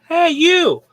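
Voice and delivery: high, loud call across the hall; king calling a humble guest forward. Retold or imitated. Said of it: "the king goes".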